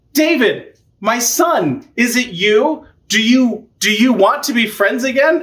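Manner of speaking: high, hopeful calling; hammed-up plea, pitched well up; mock pleading king. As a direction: call out like a needy father, retold playfully. Saul calls hopefully to David.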